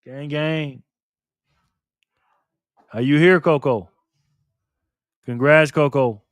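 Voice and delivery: ordinary talk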